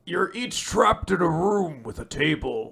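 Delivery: hammed-up horror villain voice; ominous mock villain reading out rules; put-on killer's announcement; Jigsaw. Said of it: "this is what Jigsaw sounds like".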